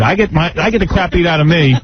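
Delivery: ordinary talk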